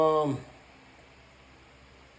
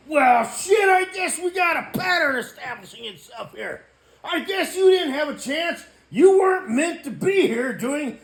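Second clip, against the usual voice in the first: much higher, loud yelling; sarcastic shouting; 13 semitones higher, 8 dB louder than usual. Real, not acted. Vlogger mocking someone who probably died, in a loud sarcastic shout. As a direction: bellow the sarcastic verdict. Loud rant at the dead person.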